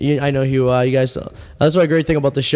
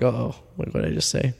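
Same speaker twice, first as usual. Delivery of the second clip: softer, lower embarrassed aside; worried aside; 4 semitones lower, 8 dB quieter than usual. Retold or imitated. Realizing he said something strange, much softer. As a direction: mutter the regret to yourself.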